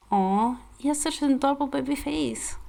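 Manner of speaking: cooing. Acted. Heroine gazing down at the sleeping boy, high coo. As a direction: melt over how cute he is.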